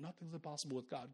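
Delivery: very soft Nothing's impossible with God; barely voiced, like waking at night; cold sweat murmur. Imagined believer waking in a cold sweat, murmuring softly. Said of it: "in a cold sweat saying".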